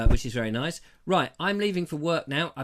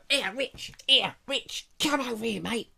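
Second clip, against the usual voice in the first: cafe owner; 6 semitones higher, slower than usual. Retold or imitated. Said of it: "he would always go".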